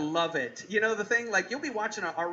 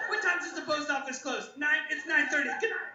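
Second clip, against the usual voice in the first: put-on high-pitched fussy old crank; hammed-up elderly voice; old letter writer; 7 semitones higher than usual. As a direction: act an old complainer checking the time. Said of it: "You just gotta be like".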